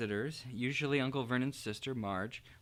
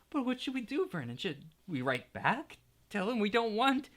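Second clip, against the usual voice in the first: anxious plea; 8 semitones higher than usual. Acted. Petunia anxiously pleading.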